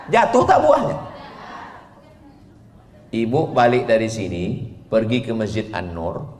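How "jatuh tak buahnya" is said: laughing shout. Real, not acted. Joking question to mothers.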